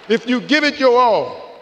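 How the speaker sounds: loud, high, drawn-out rallying call; slow it down and belt it to the crowd; rousing refrain. Real, not acted. Speaker urging graduates to give their all, rousing refrain.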